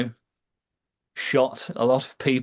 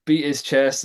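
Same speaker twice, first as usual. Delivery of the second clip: ordinary talk, nothing marked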